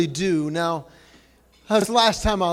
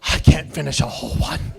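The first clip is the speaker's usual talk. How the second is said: lower, mocking voice of a snacker who can't finish; mock lazy snacker; 5 semitones lower than usual. Mocking a snacker who wants smaller candy. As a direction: lightly play a lazy M&M eater. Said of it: "eating regular M and M's going".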